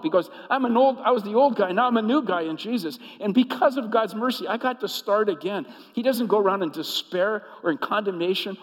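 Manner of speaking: loud, high, driving sermon delivery; full-force preaching. Acted. Pastor urging his congregation about God's mercy, loud preaching. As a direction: preach it out with rising fervour.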